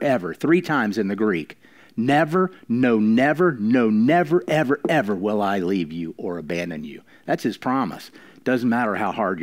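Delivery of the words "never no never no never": each never raised higher and louder; emphatic insistence. Acted. Preacher to congregation on God's promise, emphatic higher louder never. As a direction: hammer home every never.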